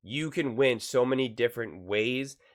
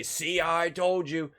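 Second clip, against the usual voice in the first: higher, drawn-out I-told-you-so; playful gloat, pitched up and stretched out; mock gloating; 5 semitones higher, much slower than usual. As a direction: crow it slowly, imitating a smug bettor. Mock gloating quote of himself.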